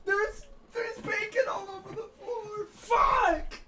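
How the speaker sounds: long, high, stretched-out wail; anguished shouting. Real, not acted. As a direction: yell in real dismay. Dropped his whole pizza.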